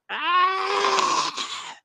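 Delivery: high, drawn-out monster scream; scream of pain. Acted. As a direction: cry out as the wounded creature. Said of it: "you hear her shout out".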